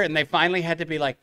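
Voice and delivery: ordinary talk, nothing marked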